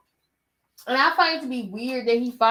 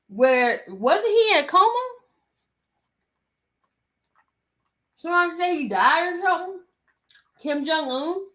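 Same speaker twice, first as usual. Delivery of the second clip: high, loud disbelief; surprised; 8 semitones higher, 4 dB louder than usual. Real, not acted. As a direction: sound shocked, voice jumping up. Disbelief at rumored death.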